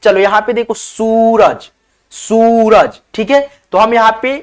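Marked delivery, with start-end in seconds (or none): 0.7-1.7 सूरज with a long, stretched "soo"; drawn-out vowel. Shown as a demonstration. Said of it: "सूरज खींच करके उच्चारण होता है". 2.1-3.0 "sooo-raj", the first vowel of सूरज held long; drawn-out vowel. Shown as a demonstration. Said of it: "सूरज खींच करके उच्चारण होता है".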